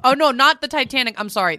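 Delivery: usual speaking voice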